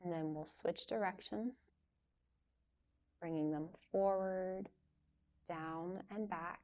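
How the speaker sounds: soft, unhurried yoga teacher voice with long pauses; guided yoga instruction. Real, not acted. Yoga teacher leading a class through gentle stretches before relaxation, calm guiding voice. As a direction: guide them softly through each stretch, leaving room to move.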